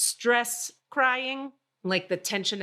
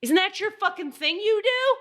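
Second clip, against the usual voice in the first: high, loud retold outrage; outraged disbelief; 12 semitones higher, 5 dB louder than usual. Stranded driver confronting tow guy, outraged disbelief. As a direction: pitch shooting up into an indignant question.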